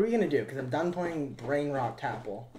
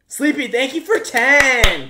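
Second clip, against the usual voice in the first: much higher and louder, slow; excited shoutout; 11 semitones higher, 14 dB louder, slower than usual. Real, not acted. Streamer loudly thanking a viewer for a donation. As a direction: belt out the thanks.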